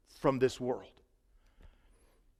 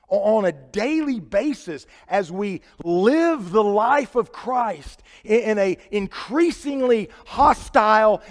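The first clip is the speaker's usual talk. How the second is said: raised, driving sermon delivery; higher-pitched, urgent pulpit voice; impassioned preaching; 6 semitones higher, 7 dB louder than usual. Real, not acted. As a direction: preach it hard, louder on key words. Preaches at full force.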